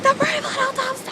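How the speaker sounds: very high, loud fangirl shriek; high squeal of recognition; excited scream. Retold or imitated. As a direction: scream the title with pure delight. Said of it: "and just screamed".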